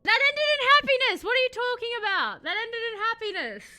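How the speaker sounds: very high, loud indignant outburst; indignant protest. Real, not acted. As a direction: squawk it in loud, high-pitched disbelief. Protests at reaction to story's ending.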